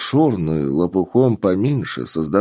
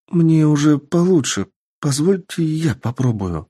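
put-on elf girl voice; 7 semitones higher than usual. Elf girl offers to help.

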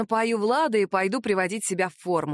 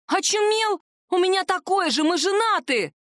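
outraged shout; 9 semitones higher than usual. A narrator's reading. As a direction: scream it in outrage. Wife yelling at husband.

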